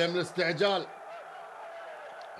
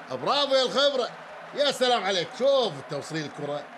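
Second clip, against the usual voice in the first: ordinary delivery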